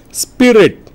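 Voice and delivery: ordinary talk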